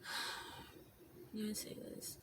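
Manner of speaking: sigh, then slow whisper; whispered to herself. Real, not acted. Vlogger unsure how to tell it, whispering to herself. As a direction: whisper it slowly while searching for words.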